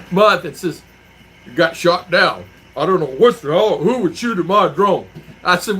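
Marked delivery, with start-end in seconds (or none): 1.5-5.1 louder, mock-outraged complaint; retold indignation. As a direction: play the baffled, aggrieved drone owner. Retells friend outraged over shot drone.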